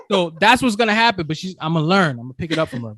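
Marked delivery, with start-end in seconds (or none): 0.0-1.3 laughing. Real, not acted. Laughs after own bit.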